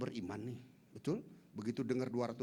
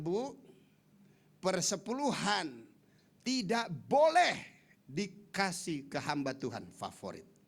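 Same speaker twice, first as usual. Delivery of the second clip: slow, louder, stressing tidak boleh; firm warning; 3 semitones higher, 5 dB louder, slower than usual. Retold or imitated. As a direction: lay down the rule word by word. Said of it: "Saya bilang". (peringatan tegas dan pelan)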